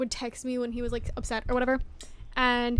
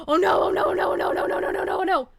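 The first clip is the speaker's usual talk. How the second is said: gasping panic; 6 semitones higher, 5 dB louder, faster than usual. Real, not acted.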